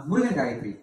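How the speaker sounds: ordinary talk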